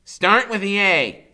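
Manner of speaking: high, loud, slow instruction; patient Freak. A narrator's reading. Freak teaching dictionary use, high slow loud. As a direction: guide him slowly, high. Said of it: "he says".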